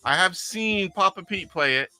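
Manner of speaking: ordinary talk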